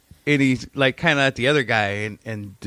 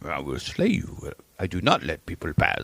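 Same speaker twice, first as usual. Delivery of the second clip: mock slayer; 5 semitones lower, 4 dB quieter than usual. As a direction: growl it like a mock knight. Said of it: "starts talking about how he's, like, gonna slay him".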